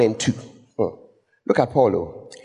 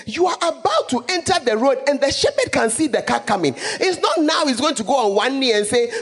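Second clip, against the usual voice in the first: ordinary talk, nothing marked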